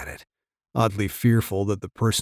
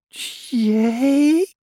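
put-on high, wailing cry of a girl; sob-scream call; 5 dB louder than usual. A narrator's reading. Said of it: "a call somewhere between a sob and a scream".

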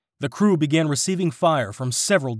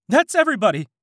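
high, loud call over gunfire; yelling; 11 semitones higher, 4 dB louder, faster than usual. A narrator's reading. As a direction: yell that all are aboard. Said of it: "Christy yelled".

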